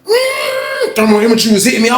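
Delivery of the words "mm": a squeaky suspicious hum; high mock hum. The scanning man sizing him up, high hum. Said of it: "he was like".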